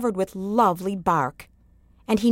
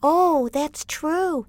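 higher, slow realizing voice; little boy Yamo; 8 semitones higher, much slower than usual. A narrator's reading. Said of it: "said little Yamo".

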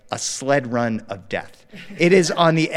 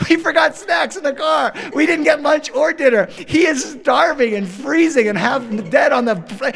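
high, raised-voice rant; loud, pitched-up exasperation; frantic dad; 12 semitones higher, 6 dB louder than usual. Retold or imitated. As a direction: exclaim in comic parental panic. Frantic father realizing son is hungry.

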